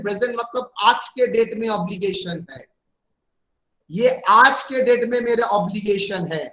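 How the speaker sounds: ordinary delivery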